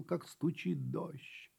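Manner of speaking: ordinary delivery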